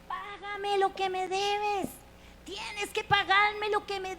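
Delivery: high, slow, pressing demand; harsh demand. Acted. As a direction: squeeze the debt out of him. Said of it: "lo agarró del cuello".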